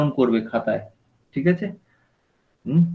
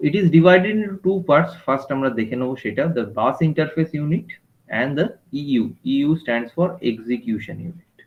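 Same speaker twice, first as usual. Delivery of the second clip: slow dictation; much slower than usual. Shown as a demonstration. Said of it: "সবাই খাতায় লেখো কিন্তু".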